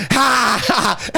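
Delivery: high, drawn-out fake laugh; hammed-up mocking cackle; Rocket. Imitating Rocket from Guardians of the Galaxy, mock laugh. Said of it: "you know the rocket from".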